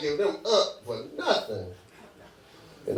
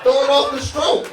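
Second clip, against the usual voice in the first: high, loud, winded complaint; mock breathless protest; out of breath; 10 semitones higher, 4 dB louder than usual. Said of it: "I'm out of breath".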